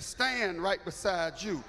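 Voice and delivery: "Stand right beside you" said slowly, word by word; slowed for a slow class. Shown as a demonstration. Pastor repeating the chief's order slowly for the congregation, slow and deliberate. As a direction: spell it out slowly for the slow ones. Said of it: "Slow class".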